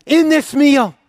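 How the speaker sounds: loud, high, ringing sermon voice; full-force preaching. Acted. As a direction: preach it out to the whole church.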